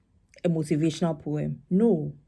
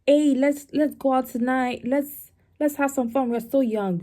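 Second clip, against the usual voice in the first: mock upbeat friend; 8 semitones higher than usual. Mock cheerful friend urging fun.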